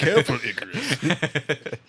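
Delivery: slow, laughing posh Brit; mock posh British. Friend answering the posh role play, laughing. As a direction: warn him like a dandy.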